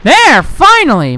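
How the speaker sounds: high, emphatic outburst of relief; exasperated relief. Real, not acted. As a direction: cry out as it works at last. Zombie finally killed.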